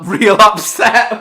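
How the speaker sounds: laughing loudly mid-sentence; breaking into laughter. Real, not acted. Laughing at kid.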